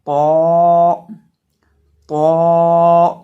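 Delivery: loud पौ with a thick, rounded long vowel; drawn-out, emphatic पौ demo; heavy, full letter. Shown as a demonstration. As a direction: make पौ full-mouthed and hold it out. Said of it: "पौ को भी हम मोटा करके पढ़ेंगे".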